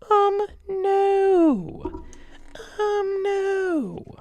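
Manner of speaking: very high, cracking whine; a squeaky, near-tearful refusal; crying refusal. Pretend. Refusing the needle pit.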